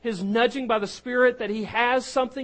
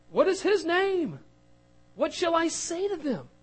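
anxious Moses; 7 semitones higher than usual. Acted. Moses voiced asking God.

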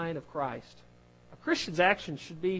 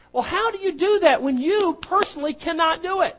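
loud, high preaching; preacher rising to a loud, high climax; full-force preaching; 9 semitones higher, 9 dB louder than usual. Acted. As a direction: drive it home to the congregation.

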